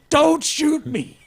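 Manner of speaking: high, slow pleading; imitating Kinsey. Host voicing the therapist begging the cops, high slow plea. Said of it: "And Kinsey's just like".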